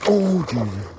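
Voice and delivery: sudden loud high gasp of surprise; startled exclamation. Real, not acted. Angler startled by a fish strike, loud high exclamation. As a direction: blurt it out as the fish hits.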